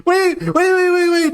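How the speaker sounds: urgent high-pitched wait, wait; excited. Real, not acted. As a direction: stop everything, eager. Excited interruption.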